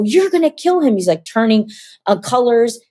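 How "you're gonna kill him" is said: high-pitched, alarmed protest; retold alarmed cry. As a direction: cry out in mock panic.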